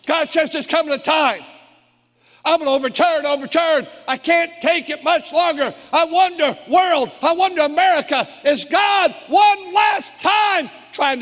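high, loud, impassioned pulpit cry; fervent revival preaching, slowing into a shout; preaching at full force. Real, not acted. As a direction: stir the congregation toward the altar call. Sermon climax at full force.

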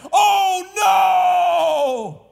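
loud, high, long-drawn wail; retold horrified cry. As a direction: act out a dying man's horror at full voice. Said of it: "he got the most horrific look".

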